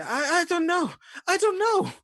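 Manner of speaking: very high, loud frightened cry; panicked brother. Acted. Brother answering his growling attacker, shrill panicked yell. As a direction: squeal it desperately, stammering at the start. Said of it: "you hear your brother just say".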